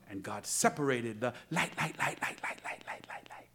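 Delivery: light repeated and fading to a whisper; preacher voicing God, light echoing away. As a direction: let it echo away into nothing.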